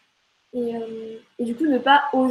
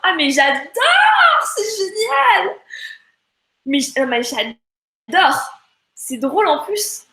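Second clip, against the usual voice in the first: high, loud, slow gush of delight; thrilled; 7 semitones higher, 7 dB louder, slower than usual. Real, not acted. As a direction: gush over it with real joy. Thrilled by a viewer's quote.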